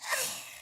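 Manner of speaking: long breathy stretch sigh. Real, not acted. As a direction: exhale through a stretch. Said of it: "Sorry, I'm stretching".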